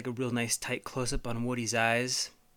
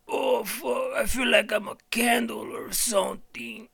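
high, strained shout; melting homie; 10 semitones higher than usual. Acted. Homie melting in the fire, high strained shout. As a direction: cry out as you melt. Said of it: "you hear one of the homies go".

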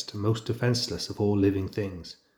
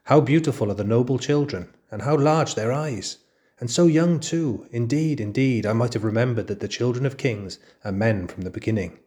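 sly flattery; 3 semitones higher, 3 dB louder than usual. Acted. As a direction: gush over the cubs with false sweetness. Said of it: "to compliment the children to their faces".

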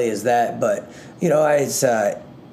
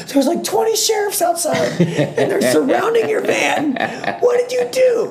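much higher, squealed mock-panic with a laugh; panicked mom. Mom warning her son about sheriffs, in a much higher frantic squeal. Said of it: "She's like".

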